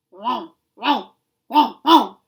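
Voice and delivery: loud barking, a mock angry big dog. A narrator's reading. Professor changing his bark for Hachi, loud mock angry dog. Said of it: "the deep growling voice of a large, angry dog".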